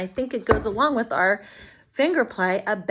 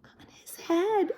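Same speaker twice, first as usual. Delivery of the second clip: high, slow answer voice; child answering the teacher; high child's answer; 10 semitones higher, 4 dB louder, slower than usual. Acted. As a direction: say it like an eager child. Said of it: "If you said this".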